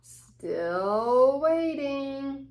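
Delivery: very slow, long-suffering sigh of a line; drawn-out still waiting. Acted. Reader to children, playing a bored wait, very slow. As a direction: stretch it out like the wait never ends.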